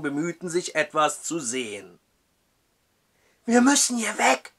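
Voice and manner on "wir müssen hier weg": pressing, high and loud; urgent. Acted. Matthew urges the judge to flee, urgent. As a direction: urge him to leave now. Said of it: "drängte Matthew".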